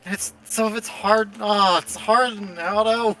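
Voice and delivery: roleplayed scrubbing. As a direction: fret over the mess as you scrub. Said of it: "have to roleplay".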